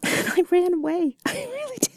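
high, helpless giggle through the words; laughing confession. Real, not acted. Confessing to a partner that she fled a man, laughing hard. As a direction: break up laughing as you admit it.

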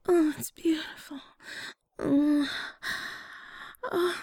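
ordinary talk, nothing marked

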